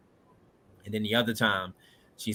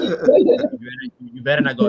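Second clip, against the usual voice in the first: laughing wife quote; 6 semitones higher, 8 dB louder than usual. Retold or imitated.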